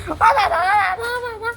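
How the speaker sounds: put-on very high excited baby voice; high, laughing pet-talk excitement; gleeful squealing. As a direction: squeal along with the overjoyed dog. Dog's glee at dad's arrival.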